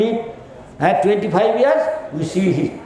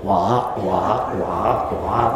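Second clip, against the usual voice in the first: frog croak; 9 semitones lower, 3 dB quieter than usual. Retold or imitated. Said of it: "like frog".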